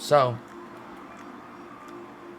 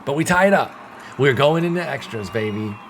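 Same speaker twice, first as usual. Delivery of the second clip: higher and louder, celebrating the tie; excited cheer; 4 semitones higher, 6 dB louder than usual. Real, not acted. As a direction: cheer it like you just escaped a loss. Game goes to extra innings.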